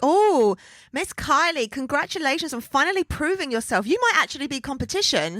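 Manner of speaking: imitating someone talking down to a rival; Ginger. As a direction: gush with condescension. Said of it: "pretty patronising of Ginger to be like".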